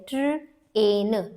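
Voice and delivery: "ट्र, एन" in two separate chunks; sounding out. Shown as a demonstration. Teacher demonstrates a vowel team, sounding out "ट्र, एन". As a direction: hold "ट्र" apart from "एन". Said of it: "इसे break नहीं करेंगे".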